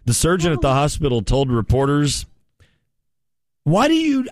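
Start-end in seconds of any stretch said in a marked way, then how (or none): 3.6-4.3 high mock voice. Surgeon voiced in a story.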